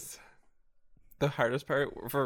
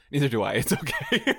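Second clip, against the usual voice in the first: loud punchline breaking towards laughter; loud comic reassurance; 10 dB louder than usual. Retold or imitated. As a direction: blurt it out loudly. Retold punchline to customer.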